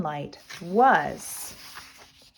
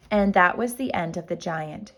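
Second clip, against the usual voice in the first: plain reading voice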